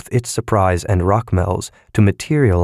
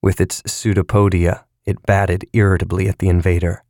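usual speaking voice

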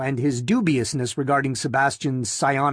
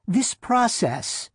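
slow, higher, resolute woman's voice; unyielding; 8 semitones higher, slower than usual. A narrator's reading. Wife defending the process that saved her husband, unyielding. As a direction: begin deliberately, pitched up and firm. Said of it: "was unyielding".

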